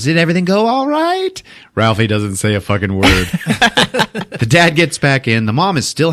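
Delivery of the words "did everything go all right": high, rising mom voice; chirpy mom. Retold or imitated. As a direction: imitate a cheerfully oblivious mom asking. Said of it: "His mom just goes".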